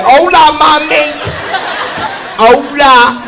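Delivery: loud, high cheesy Hola; hammed-up flirting.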